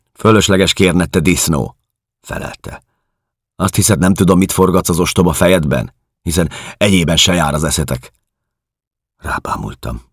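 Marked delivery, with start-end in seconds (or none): 0.1-8.1 raised, louder angry woman's retort; hostile woman, scornful. A narrator's reading. Woman at the fence rebuffing a stranger, scornful. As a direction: cut him down with contempt.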